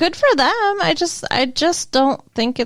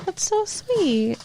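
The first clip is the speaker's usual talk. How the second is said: soft, slow, higher aww; touched aww; 4 semitones higher, 4 dB quieter, much slower than usual. Real, not acted. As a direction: melt at the sweet words. Touched response.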